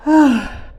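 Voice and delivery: loud, high groan of strain; effort groan. Real, not acted. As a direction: heave it out like lifting something heavy. Climbing out, physical strain.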